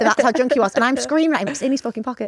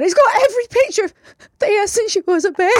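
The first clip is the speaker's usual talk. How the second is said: mock crying wail; 11 semitones higher, 3 dB louder than usual. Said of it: "And I started crying".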